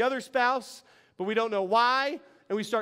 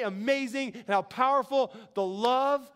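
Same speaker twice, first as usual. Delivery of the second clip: ordinary talk, nothing marked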